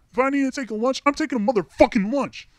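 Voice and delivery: retold defiant outburst.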